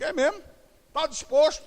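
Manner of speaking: high, mock-doubtful "É mesmo?"; teasing question. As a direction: raise the pitch, testing him. Pastor's teasing reply quoted. (pergunta zombeteira, aguda)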